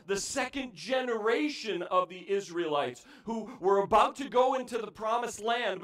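ordinary talk

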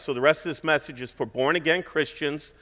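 usual speaking voice

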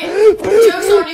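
yelling across the room. Real, not acted. Shouting back at clip.

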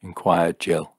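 ordinary delivery, nothing marked

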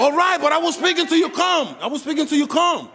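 high-pitched indignant protest; heated yelling. Real, not acted. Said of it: "You yell it".

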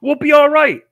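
high, loud, breezy reassurance; imitating insistent friend. Friend waving away talk of jail, retold with high insistence. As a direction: brush off the worry, pitched well up.

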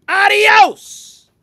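high, loud farewell shout; loud dismissal. Acted. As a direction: fling out a curt goodbye. Martina dismisses the lizard.

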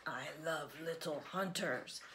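hushed, menacing monster voice; creepy monster whisper. A narrator's reading. The scary thing eyeing the little hunter, whispering. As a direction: whisper it like a hungry beast.

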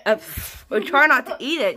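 lips held by fingertips; speaking through pinched lips; fingers pressing on lips. Real, not acted. Seen 1: Fingers press sheet mask edges onto lips throughout the words.

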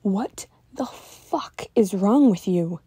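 slow, furious scream at a friend; angry scream. A narrator's reading. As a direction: hurl it at him, slow and enraged. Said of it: "I scream".

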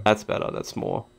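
ordinary talk, nothing marked

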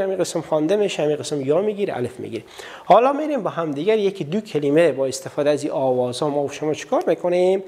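ordinary talk

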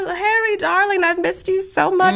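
high, gushing mock-motherly voice; hammed-up medium. Medium posing as Houdini's dead mother, high mock-doting voice. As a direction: gush like a phony spirit. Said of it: "She's like".

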